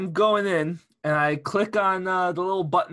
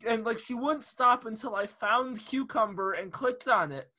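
ordinary delivery

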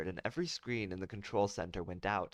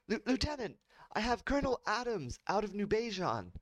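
excited officer; 13 semitones higher than usual. Acted.

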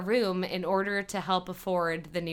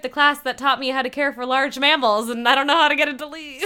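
rushed, higher and louder imitated rambling; awkward; 5 semitones higher, 7 dB louder, faster than usual. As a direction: babble through an awkward confession, breaking into laughter. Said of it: "Ted is super awkward".

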